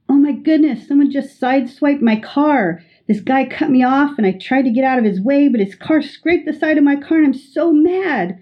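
high-pitched, worked-up venting about the scraped car; upset friend. Acted. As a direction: play the rattled friend who just got sideswiped. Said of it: "your friend comes in and says".

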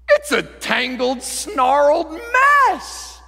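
high, drawn-out cry on "mess"; slow build to a loud, stretched shout; exasperated shout. Acted. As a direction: wail it out in mock horror. Dramatizes the back of tapestry.

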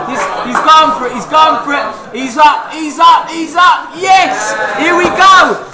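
live commentary. Real, not acted. Live commentary on a drinking race.

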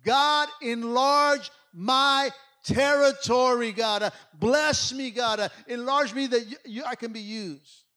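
loud, slow, pleading cry; a desperate plea thrown upward to God. Acted. Jabez crying out to God in prayer, loud and slow. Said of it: "So he cries out".

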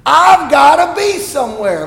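high, loud exasperated cry to heaven; exasperated outcry. Retold or imitated. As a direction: cry out like he's wasting your whole day. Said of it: "I mean, it's like".

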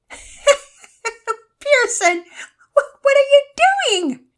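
put-on high, loud girl voice through laughter; laughing. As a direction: giggle out the question. Penelope laughing at brother.

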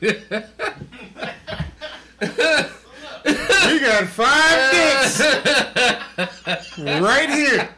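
loud, slow, laughing shout; rowdy shout. Retold or imitated. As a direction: yell it out, drawn out and rowdy. Young dudes shouting at couple.

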